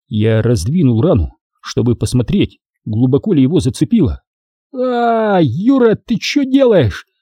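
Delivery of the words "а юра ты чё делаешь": high-pitched drawn-out yelp of protest; pained cry out. A narrator's reading. Wounded soldier crying out at his comrade, high protesting yell. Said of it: "закричал качок".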